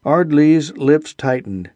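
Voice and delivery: ordinary delivery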